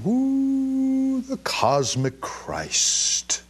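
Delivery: high swooping "Ooh", then slow dreamy words; breathy mock guru drawl; mock mystic. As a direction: parody a navel-gazing mystic. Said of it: "as they're going".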